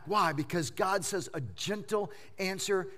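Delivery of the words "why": usual speaking voice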